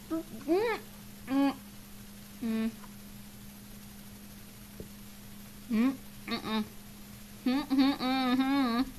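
cheeks stuffed full. Real, not acted. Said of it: "We're gonna try to do Chubby Bunny".

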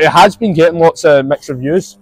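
normal talking voice

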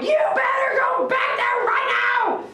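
high, loud yell at the owner; retold yell. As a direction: shout the order high and hard. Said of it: "and I'm like".

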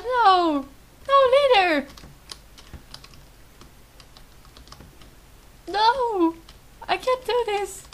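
high, wailing grief; distraught crying. Real, not acted. Player mourns the shot leader, distraught high wail. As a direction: cry out as if it's unbearable. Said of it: "I'm actually crying".

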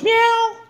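very high imitated meow; high-pitched mock mew; cat meow. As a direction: mimic a cat's meow. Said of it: "My cat sits there and goes".

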